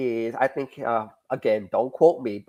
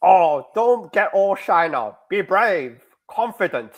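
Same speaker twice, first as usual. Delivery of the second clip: put-on higher, drawn-out Russian-accented coaxing; horrible Russian accent; 4 semitones higher, 4 dB louder, slower than usual. The girl urging the shy guy on, Russian accent. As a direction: coax in a bad Russian accent, stretching the words. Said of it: "I do a horrible Russian accent".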